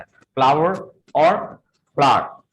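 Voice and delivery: फ्लावर as "flaar", then "flaa-wer"; दो उच्चारण: फ्लार, फ्लावर; two pronunciations. Shown as a demonstration. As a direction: contrast both flour versions, slowly. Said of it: "दो तरह से इसको English में pronounce".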